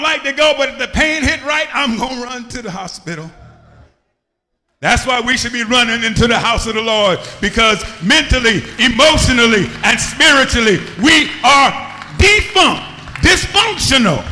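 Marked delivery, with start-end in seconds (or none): 4.8-14.2 climbing louder word by word into a shout; building sermon. Acted. Pastor telling the church everyone is dysfunctional, building to a shout. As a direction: build it up until you shout the last line.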